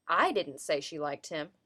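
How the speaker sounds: I punched at the start, denying it was me; stress on I. Shown as a demonstration. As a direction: lean hard on I, as if someone else said it. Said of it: "makes it sound like they're denying".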